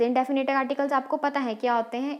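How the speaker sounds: normal talking voice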